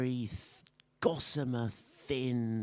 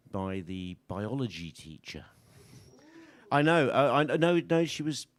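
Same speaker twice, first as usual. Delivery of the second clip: usual speaking voice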